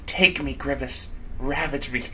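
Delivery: lightly voiced bedroom tease; mock seductive woman. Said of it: "She's all like".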